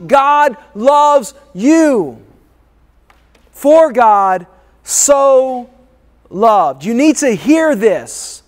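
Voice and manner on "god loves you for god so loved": slow emphasis. Acted. Preacher stressing God's love.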